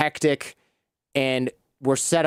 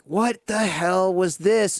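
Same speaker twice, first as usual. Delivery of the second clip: slow, drawn-out, pitched-up disbelief; exasperated; 6 semitones higher, much slower than usual. Retold or imitated. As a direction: stretch it out, baffled and fed up. Exasperated at video guy.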